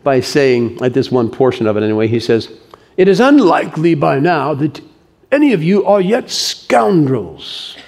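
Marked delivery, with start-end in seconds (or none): 3.0-7.8 quoted lecturer's pronouncement. Acted. Quoting a lecture to young people.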